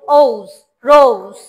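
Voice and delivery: "রোজ" slow and loud with a gliding ou; long ou vowel. Shown as a demonstration. Teacher modelling for pupils, "রোজ" with a slow, loud ou. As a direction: say "রোজ" as rouz, vowel stretched. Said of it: "ওর মতো উচ্চারণ করি".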